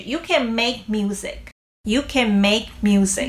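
can said light, stress on make music; unstressed can. Shown as a demonstration. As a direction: keep can short and weak. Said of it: "那我们再来肯定句".

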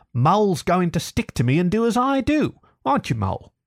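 high-pitched, emphatic refusal; dogged. A narrator's reading. Rat insists on staying by the river, doggedly. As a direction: dig in, punching up each stubborn point. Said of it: "said the Rat doggedly".

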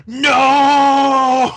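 mock dying villain's cry of no; a hint of a hammed-up death scream. Host mimicking the villain's missing cry of no. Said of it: "get Naraku going".

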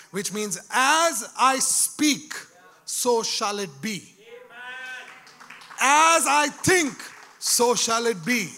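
slow, loud, weighty pronouncement; slow declaration. Real, not acted. Preacher proclaiming the power of his words, slow and loud. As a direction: lean on every word, slowly.

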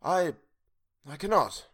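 hesitate, then refuse; halting refusal. A narrator's reading. Old man refusing to approach, halting.

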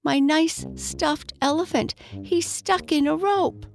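put-on high plaintive little boy; holding back tears. A narrator's reading. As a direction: plead high, trying not to cry. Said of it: "trying to keep from crying".

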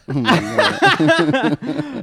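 slow, high laughing oh my God; laughing in disbelief. Real, not acted. Laughs at repeated catchphrase.

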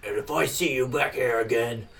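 slow, menacing orc voice, drawn out; threatening orc. Acted. Orc bouncer warning a thrown-out human, slow and menacing. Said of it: "one of the orcs points and says".

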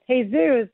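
"Jesus" said as hay-ZOOS; Spanish pronunciation. Shown as a demonstration. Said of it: "in Spanish world".

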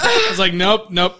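usual speaking voice

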